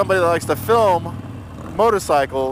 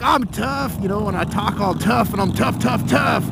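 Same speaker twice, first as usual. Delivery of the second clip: higher mock tough-guy boast; 5 semitones higher than usual. Vlogger mocking tough-talking bikers, higher hammed-up boast. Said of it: "there's hundreds of you that will be like".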